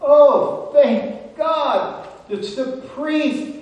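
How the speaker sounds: high, loud plea up from a pit; relieved calling out. Acted. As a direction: call up with relief and urgency. Trapped man calls up to priest.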